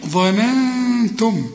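ظننتم with a thin "neh" after the heavy ظ, nasal hum held; light noon. Shown as a demonstration. Teacher demonstrating the right reading, light noon in ظننتم. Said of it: "رقق النون".

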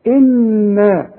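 إنا with a long nasal hum on the doubled نون; nasalized nun. Shown as a demonstration. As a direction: hold the ghunna in إنا. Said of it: "كل نون عليها شدة لازم تغن".

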